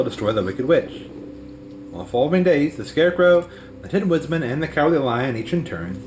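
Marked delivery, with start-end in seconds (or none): none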